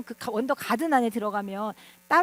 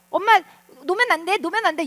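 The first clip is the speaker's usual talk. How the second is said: put-on loud, higher-pitched child's plea to a parent; child calling out; 5 semitones higher, 6 dB louder, faster than usual. Child on a bike to mom holding on behind, calling out. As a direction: call out urgently like a kid on a bike.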